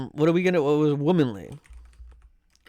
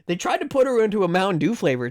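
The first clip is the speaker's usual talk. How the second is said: usual speaking voice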